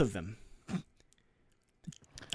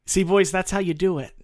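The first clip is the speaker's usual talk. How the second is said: teasing brag; 6 semitones higher, 5 dB louder than usual. Real, not acted. Teasing the boys triumphantly.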